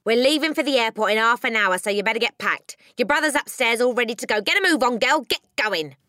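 loud, hurried rough-dad orders; brusque, impatient father. Acted. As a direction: hustle the girl along impatiently. Brusque father hurrying his daughter.

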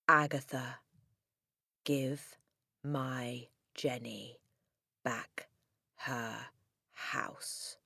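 very soft, slow, word by word; whispery, with long pauses; ghostly written message. A narrator's reading. Dead Magnus demanding Jenny's house, hushed.

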